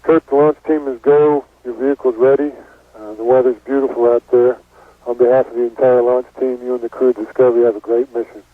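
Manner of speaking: astronaut radio. Real, not acted. Launch director radio exchange.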